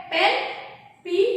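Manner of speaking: normal talking voice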